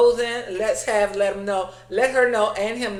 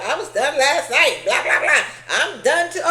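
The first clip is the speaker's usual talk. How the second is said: snippy retort; 4 semitones higher, 5 dB louder than usual. Retold or imitated. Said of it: "She said".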